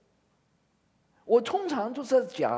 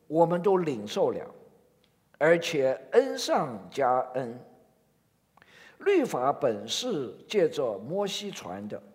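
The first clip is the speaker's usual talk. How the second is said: slow, measured Bible reading; scripture reading; 6 semitones lower than usual. Acted. Pastor reading John to the congregation, slow and solemn. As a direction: read the verses slowly and solemnly.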